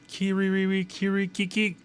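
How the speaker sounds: high, sing-song "Kiriri, Kirikiki" cackle; mock character laugh. Character's signature laugh voiced.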